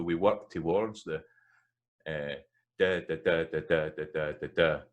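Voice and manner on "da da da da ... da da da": da, da, da in steady even pulses; musical beat. Shown as a demonstration. Teacher showing a musical beat, da chanted evenly. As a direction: chant da firmly on a regular beat. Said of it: "so we get a musical beat".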